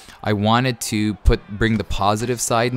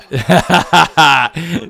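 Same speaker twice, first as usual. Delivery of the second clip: amused laugh; 7 semitones higher, 8 dB louder than usual. Real, not acted. Laughing at his own boast.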